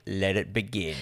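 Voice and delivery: low, soft villain line; lightly voiced ominous count; Dracula. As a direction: intone it low and quiet, like a movie villain. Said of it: "he says something like".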